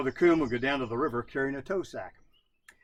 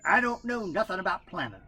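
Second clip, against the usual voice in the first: Brother Coon voice; 6 semitones higher, 3 dB louder than usual. A narrator's reading. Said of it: "said Brother Coon".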